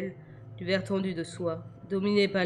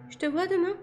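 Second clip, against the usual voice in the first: put-on much higher, sudden hopeful girl; girl asking; 12 semitones higher than usual. A narrator's reading. Girl abruptly asking the vampire if she'll see him tomorrow. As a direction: ask high and out of the blue. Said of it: "s'enquit-elle sans crier gare".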